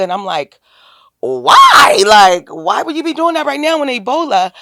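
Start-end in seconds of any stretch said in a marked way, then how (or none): 1.2-4.5 a higher, louder mock outcry of disbelief; incredulous why. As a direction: retell your stunned reaction with a mock yell. Asking him why he'd go.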